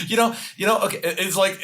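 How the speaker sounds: laughing. Real, not acted. Laughs at host's joke.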